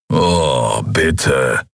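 low, eye-rolling plea; exasperated. Acted. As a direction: groan it out wearily in character. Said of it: "Keru verdrehte das Auge".

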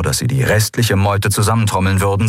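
plain reading voice

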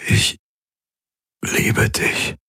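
forced out, barely voiced; strained whisper. Dying Galen to Kirai, whispering with effort. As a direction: squeeze the words out with the last strength. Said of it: "brachte er hervor".

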